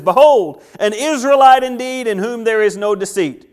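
high, loud, ringing declaration; Jesus hailing Nathanael from afar, proclaiming. Acted. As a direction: announce him to the crowd. Jesus greeting Nathanael loudly.

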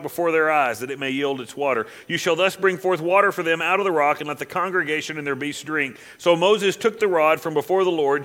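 loud, rising scripture reading; preaching scripture. Acted. Preacher reading Numbers aloud to the church, at full force. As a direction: proclaim it to the congregation.

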